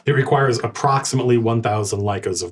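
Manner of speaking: ordinary talk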